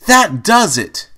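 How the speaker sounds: loud, high, slow furious shout; angry shout. Acted. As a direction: blow up at Pinkie, fed up. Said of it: "Rainbow Dash shouted".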